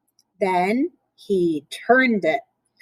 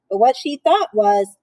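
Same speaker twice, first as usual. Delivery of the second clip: ordinary delivery, nothing marked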